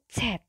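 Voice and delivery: Z said as tset; German Z. Shown as a demonstration. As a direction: give Z a ts sound, tset. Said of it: "pengucapan untuk Z".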